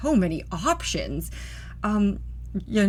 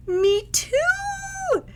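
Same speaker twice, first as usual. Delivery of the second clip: excited squeal. Acted. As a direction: squeal with delight. Joan bursts out excitedly.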